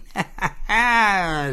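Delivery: short high sneering laugh; a little wicked snicker; gloating pig laugh. Acted.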